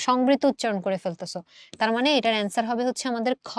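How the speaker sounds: ordinary delivery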